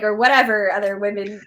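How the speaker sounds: ordinary delivery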